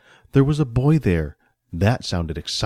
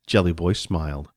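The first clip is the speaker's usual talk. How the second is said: usual speaking voice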